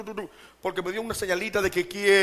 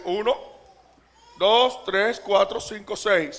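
ordinary delivery

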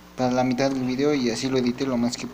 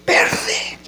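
verde repeated louder, unvoiced; 4 dB louder than usual. Shown as a demonstration. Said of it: "Si no me escucharon".

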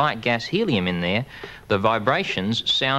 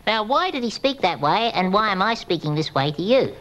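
high squeaky voice from inhaled helium; 9 semitones higher than usual. Real, not acted. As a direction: talk with a lungful of helium. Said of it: "because my lungs and windpipe and throat are filled with this gas helium".

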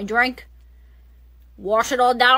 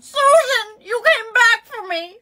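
relieved cry; 6 semitones higher than usual. Acted.

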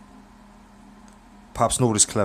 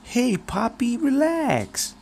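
much higher, smiling hustler voice; smiling Clever; much slower than usual. Acted. Clever smiles at the old man and tells him to relax, high and slick. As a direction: grin through it, easy and slick. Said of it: "says with a smile".

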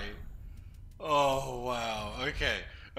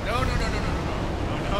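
panicked no; 7 semitones higher, 7 dB louder than usual. Real, not acted. Panic during boss fight.

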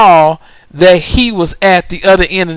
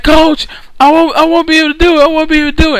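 much higher, whiny plea; exhausted player pleading; 8 semitones higher, much faster than usual. Retold or imitated. Player tells the coach he won't make it, in a high, pained plea. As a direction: sound like you have nothing left.

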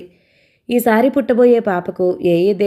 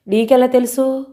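suspicious; 6 semitones higher than usual. A narrator's reading. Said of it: "అనుమానంగా అడిగింది".

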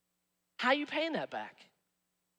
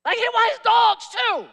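outraged shout; 10 semitones higher, 8 dB louder than usual. Real, not acted. Comic outrage in sermon.

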